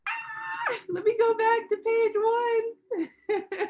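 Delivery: high flustered yelp, fast; startled and laughing at herself; fast excited shout; surprised squeal. Real, not acted. Flustered by twenty-one pages of names.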